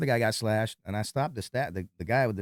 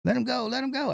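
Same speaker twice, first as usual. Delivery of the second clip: high-pitched mild mock yell; retold scream; 13 semitones higher than usual. Bouncer retelling the crowd fight, mild high-pitched mock scream. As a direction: imitate frantic screaming without full volume. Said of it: "I'm screaming".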